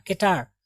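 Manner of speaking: केटार as kay-TAAR, no final vowel; correct pronunciation. Shown as a demonstration. Teacher correcting the misreading for students, केटार with no final aa. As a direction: say केटार, ending on the r. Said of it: "कटारा नहीं पढ़ेंगे आप".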